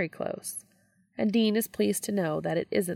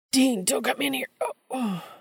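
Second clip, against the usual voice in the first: higher, louder panicked cry; 8 semitones higher, 3 dB louder than usual. A narrator's reading. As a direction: shout him off, then gasp. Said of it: "Sammy cries".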